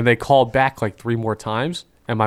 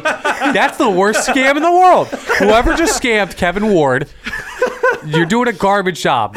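laughing; 11 semitones higher, 5 dB louder, slower than usual. Real, not acted. Laughing mock at scam caller.

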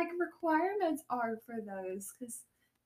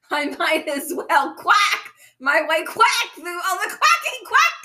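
quacks rising higher and louder; duck voice; 6 semitones higher, 10 dB louder than usual. Pretend. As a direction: quack your way through, building up. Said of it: "me saying quack".

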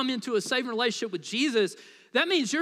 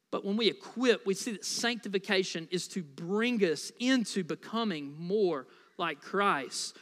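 normal talking voice

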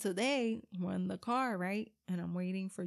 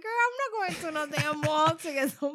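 louder, higher mock-angry scolding; 7 semitones higher, 6 dB louder than usual. Mom to daughter wanting mall trip, mock-angry scolding. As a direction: scold her daughter, laughing at the nerve.

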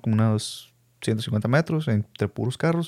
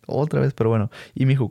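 ordinary talk, nothing marked

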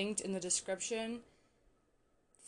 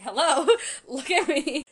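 higher, louder, laughing hello; louder imitated call; 7 semitones higher, 10 dB louder than usual. Retelling the scene to viewers, louder laughing mock call. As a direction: call out again, pushing it with a laugh.

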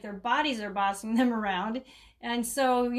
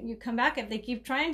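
ordinary talk

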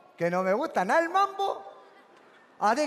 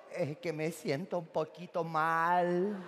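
low, slow, faint sick mumble; feeble sick voice; 9 semitones lower, 8 dB quieter, slower than usual. Retold or imitated. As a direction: sound weak and ill, slowly. Sick friend answering weakly.

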